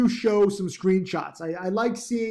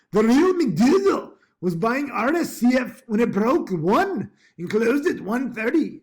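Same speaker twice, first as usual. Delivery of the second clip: playful mock Scottish accent, louder and slow; 5 semitones higher, 5 dB louder than usual. Trader reporting a student's trade in a playful Scottish accent. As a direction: keep up a joking Scottish brogue. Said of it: "try to do the Scottish accent".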